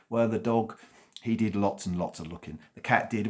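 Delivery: usual speaking voice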